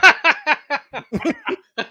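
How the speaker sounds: slow laughing tease. Real, not acted. Laughing while teasing co-host.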